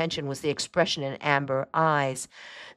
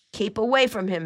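higher-pitched, urgent warning; 6 semitones higher than usual. A narrator's reading. Aunt warns niece about the sick cat, warning. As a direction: warn her to keep back. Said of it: "Matilda warned".

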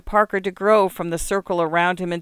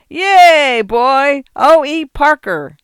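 big, much higher hearty yell, drawn out slowly; 9 semitones higher, 11 dB louder, much slower than usual. A narrator's reading. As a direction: whoop a greeting across the pool hall.

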